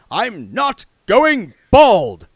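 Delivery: slow, loud furious shout. Acted. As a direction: roar it out. Arch-Chancellor explodes in denial.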